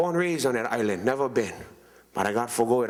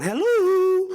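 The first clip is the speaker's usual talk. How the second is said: high, louder "Hello?" like a mainland mom; mother on the phone; 5 dB louder than usual. Retold or imitated. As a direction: squeak out a hello as his mother. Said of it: "who the hell was that?".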